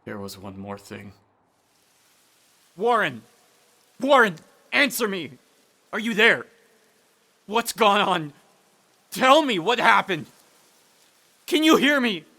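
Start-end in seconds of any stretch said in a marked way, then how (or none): 2.8-12.2 frantic rising shouts; calling louder and higher each time. Acted. Carter frantically shouting down to Warren, rising.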